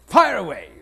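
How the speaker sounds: loud, high theatrical go-ahead; imitating a booming, expansive actor; hearty shout. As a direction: call it out grandly, inviting the story. Imitated actor invites story.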